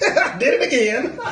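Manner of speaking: ordinary delivery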